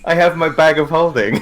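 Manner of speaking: high, loud insistence through giggles; laughing protest. Real, not acted. Player defends bag plan to teammate, laughing protest. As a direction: argue back while laughing.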